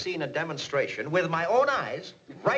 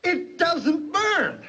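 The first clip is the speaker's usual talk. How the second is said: drawn-out, higher stunned wonder; slow amazed; 11 semitones higher, 4 dB louder, much slower than usual. Acted. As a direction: savour each word in awe. Amazed boss repeats result.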